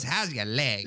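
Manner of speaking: taunting leprechaun. Acted. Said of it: "Leprechaun runs past Nathan".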